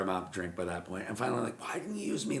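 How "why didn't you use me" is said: high-pitched, hurt mock complaint; wounded protest, retold; upset retold plea. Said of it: "I was so upset".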